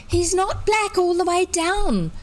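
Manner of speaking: put-on high shrill little girl; shrill it out like a small girl. A narrator's reading. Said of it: "she shrilled".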